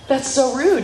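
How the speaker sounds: high pitched mock indignation; a touch of shocked judge. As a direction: gasp at the cheek of it. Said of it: "retold reply".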